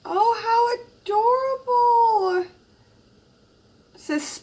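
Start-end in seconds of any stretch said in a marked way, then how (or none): 0.1-2.5 high, loud squeal of delight, near tears; gushing high-pitched joy. Real, not acted. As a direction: squeal it out, overcome by the gift. Unboxing a gift swap, overjoyed.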